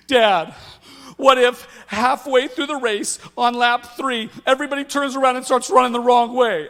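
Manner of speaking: mock panic, each worry wilder; anxious daughter. Daughter imagining the race going wrong, hammed-up panic.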